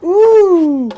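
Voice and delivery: high, slow, drawn-out cartoon pig voice; squealing "Woo!" then a slow, high pig line; first little pig. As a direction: stretch it out like a giddy little pig. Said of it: "the third pig was like".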